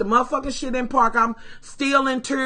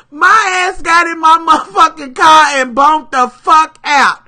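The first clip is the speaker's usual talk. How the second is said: ordinary delivery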